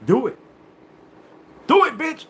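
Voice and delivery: high, loud taunt; a loud, high challenge; daring shout. Pretend. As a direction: yell the dare at him. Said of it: "I'd go".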